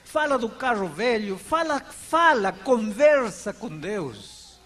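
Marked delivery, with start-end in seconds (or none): 1.4-4.6 drawn-out, pressing words; slow insistent urging. Real, not acted. Pastor urging listeners to talk to God, slow and insistent. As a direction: plead each word slowly.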